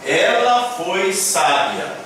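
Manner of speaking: unhurried teacher read-aloud; calm, slow model reading. Shown as a demonstration. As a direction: say it slowly so students follow. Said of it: "está escrito".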